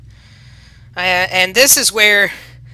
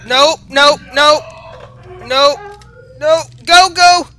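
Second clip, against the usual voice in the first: high, loud panicked babbling; 5 semitones higher, 6 dB louder than usual. Real, not acted. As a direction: blurt it in panic while fleeing. Fleeing a chasing monster.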